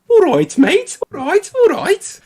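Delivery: very high, loud mock Scouse; comedy-sketch Scouse caricature; Scouse accent. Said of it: "as far as I go with Scouse".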